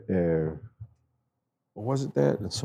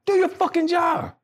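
shouted order. Retold or imitated. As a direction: yell it at whining troops. Telling complaining troops to work.

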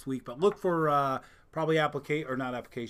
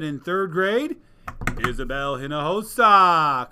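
announcer call; 12 dB louder, slower than usual. Real, not acted. Announcing award winners.

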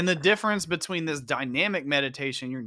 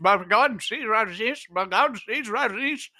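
put-on higher Indian accent on Bhagwan Sri Rajneesh; 7 semitones higher than usual. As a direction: repeat the name with an Indian accent. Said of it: "he said it in an Indian accent".

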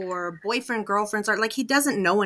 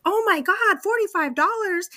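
much higher, louder gasp at the price; imitating a boy shocked at a price tag; son's shocked exclaim; 12 semitones higher, 3 dB louder than usual. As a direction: shriek the price like a stunned kid. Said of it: "and he goes".

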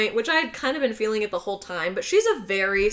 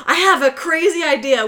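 loud, higher-pitched mock frenzy; fit of crazy; 6 semitones higher, 6 dB louder than usual. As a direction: blurt it like someone having a manic brainwave. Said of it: "fits of crazy where she's like".

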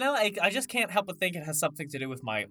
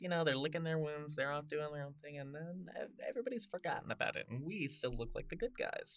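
hushed aside; 12 dB quieter, faster than usual. Acted. Quiet conspiratorial aside to co-host.

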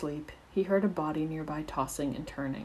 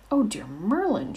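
higher, louder gasp of horror; horrified exclamation; 6 semitones higher, 6 dB louder than usual. A narrator's reading. Pomfrey hears the Willow hurt Remus, horrified exclamation. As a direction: exclaim in shock. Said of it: "she exclaimed".

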